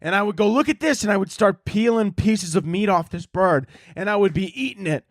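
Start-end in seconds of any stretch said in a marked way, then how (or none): 0.5-1.0 high-pitched, hammed-up call; mock yell. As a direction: yell it up to the birds. Repeats his yell at the birds.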